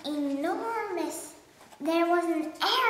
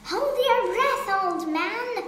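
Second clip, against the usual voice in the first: higher, louder cheering; monkey egging on the pelican; excited monkey; 6 semitones higher, 5 dB louder than usual. Acted. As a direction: whip up the crowd.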